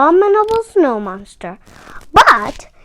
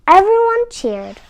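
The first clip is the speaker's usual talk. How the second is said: ordinary delivery, nothing marked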